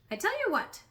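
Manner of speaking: high, eager donkey chirp; donkey cheerfully cutting in with a suggestion; brightly. Acted. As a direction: pop up with a bright idea. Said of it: "said the donkey brightly".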